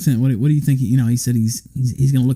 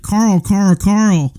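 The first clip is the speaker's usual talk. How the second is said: mild mock calling out; 6 semitones higher, much slower than usual. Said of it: "speaking loudly towards Sargon".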